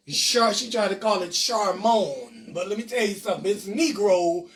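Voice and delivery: normal talking voice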